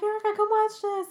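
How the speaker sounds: mock parental holler; imitated father calling. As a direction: summon your kid to the TV, drawn up high.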